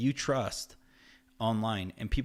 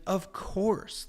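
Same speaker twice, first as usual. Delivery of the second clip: higher, eager yes; quick happy agreement imitated; imitating excited friend; 8 semitones higher than usual. Friend agreeing to the project, lightly excited. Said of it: "Like, he was excited".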